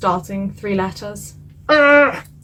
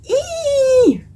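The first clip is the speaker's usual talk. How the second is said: very high, short piercing squeal; 4 dB louder than usual. Shown as a demonstration. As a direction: squeal as a sample noise. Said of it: "something along the lines of".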